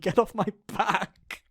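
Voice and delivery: slow, giggling get off my back; laughing retort. Retold or imitated. Mock retort to a snappy anime character, laughing. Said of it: "And I'm like".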